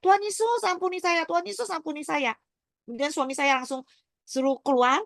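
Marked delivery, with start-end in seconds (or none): 0.0-2.3 retold crying out. Survivor re-enacts begging Jesus for mercy, retold crying out. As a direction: re-enact a pitched-up cry, easing down by the last. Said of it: "Saya teriak sama Tuhan Yesus".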